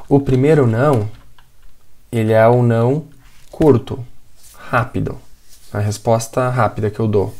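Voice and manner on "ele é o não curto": ordinary talk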